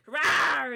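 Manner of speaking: dog attack roar. Retold or imitated. Imitating an attacking dog to co-host, loud roar. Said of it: "attack and be like".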